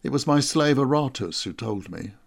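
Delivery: ordinary delivery, nothing marked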